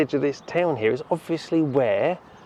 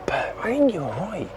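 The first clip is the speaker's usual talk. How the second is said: whispering; 7 semitones higher than usual. Real, not acted. Talking quietly after the church.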